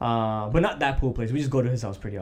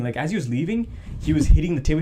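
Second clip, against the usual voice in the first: normal talking voice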